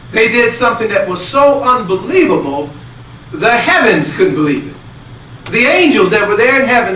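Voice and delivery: high, loud, fervent preaching; full-force preaching. Acted. Preacher telling the blessing story to the congregation, at full force. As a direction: drive it home to the congregation.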